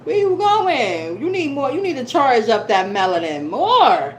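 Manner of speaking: high playful scolding sun; sassy sun voice. Pretend. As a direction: tease like the summer sun. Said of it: "Outside was like".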